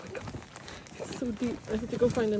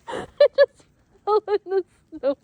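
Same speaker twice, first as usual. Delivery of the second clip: laughing; 6 semitones higher, 6 dB louder than usual. Real, not acted. Laughing after falling in snow.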